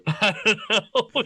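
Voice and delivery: slow, high, laughing through the words. Real, not acted. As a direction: giggle it out, barely holding it together. Laughing at her own idea.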